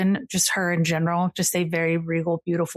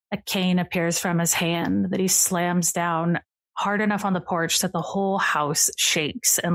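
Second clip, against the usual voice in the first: ordinary delivery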